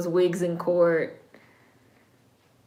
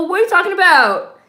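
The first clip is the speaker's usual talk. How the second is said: high, louder, exaggerated disbelief with a changed voice; louder incredulous mock; 10 semitones higher, 8 dB louder, faster than usual. Viewer repeating the heroine's line as a hammed-up loud protest. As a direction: push the disbelief over the top. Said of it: "She's like".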